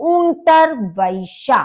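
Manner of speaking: unterwäsche drawn out, ä as e not a; slow German pronunciation. Shown as a demonstration. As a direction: model unterwäsche very slowly. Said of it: "आ के बदले इसको ए की तरह से बोलते हैं". (unterwäsche धीरे, आ नहीं ए)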